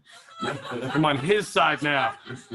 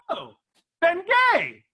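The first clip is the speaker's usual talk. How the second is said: eureka; 13 semitones higher, slower than usual. Retold or imitated. Sudden idea, retold.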